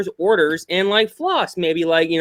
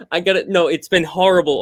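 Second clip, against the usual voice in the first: usual speaking voice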